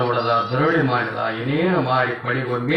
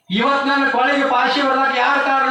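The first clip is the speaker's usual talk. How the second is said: much higher, louder accusing cry; anguished shout; 10 semitones higher, 4 dB louder than usual. Retold or imitated. Condemned son cries out to his mother, much higher and louder. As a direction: cry out the question in despair.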